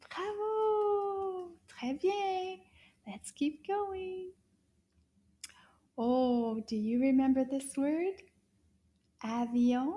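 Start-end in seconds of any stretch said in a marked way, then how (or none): 0.1-4.3 high bright praise. Real, not acted. Praising the pupil.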